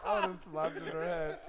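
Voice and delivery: laughing. Real, not acted. Laughing outrage at story.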